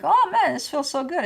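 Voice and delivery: playfully imitating a thrilled client; mock excited gush. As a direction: act out the rush of a good feeling. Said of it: "one minute you're like".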